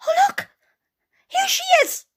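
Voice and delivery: very high, loud little-bear squeal; Baby Bear shout. Acted. Baby Bear discovers Goldilocks, high shout. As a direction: shout it out in a tiny child's voice. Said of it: "let out a shout".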